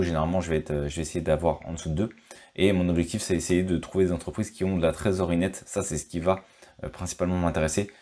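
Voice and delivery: ordinary talk, nothing marked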